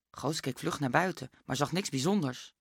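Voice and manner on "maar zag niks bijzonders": plain reading voice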